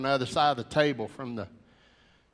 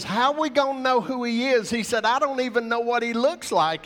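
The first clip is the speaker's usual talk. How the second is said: laughing through tears; 10 semitones higher, 5 dB louder than usual. Retold or imitated. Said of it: "he's trying to laugh, and he's crying".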